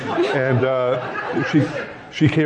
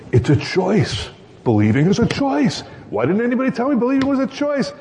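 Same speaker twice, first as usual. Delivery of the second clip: excited discovery; 9 semitones higher than usual. Retold or imitated. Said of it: "she came up and said".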